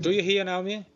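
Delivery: loud and clear for a listener who can't hear; speaking up. Real, not acted. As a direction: raise your voice so they can hear. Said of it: "Can you please speak up".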